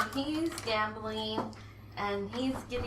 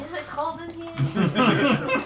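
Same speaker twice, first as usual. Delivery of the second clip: slow, giggly mock flirting; can barely get it out for laughing; 5 semitones higher, 8 dB louder, much slower than usual. Mock seduction scene, laughing.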